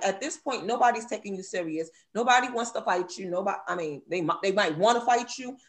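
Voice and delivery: ordinary talk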